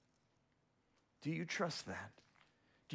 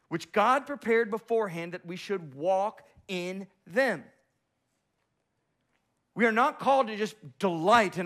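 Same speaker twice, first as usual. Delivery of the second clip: full force preaching; 9 semitones higher, 9 dB louder than usual. Acted. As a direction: build to a ringing climax.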